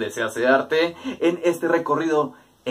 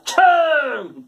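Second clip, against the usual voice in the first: playful sneeze; 12 semitones higher, 4 dB louder than usual. Pretend. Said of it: "me haces estornudar".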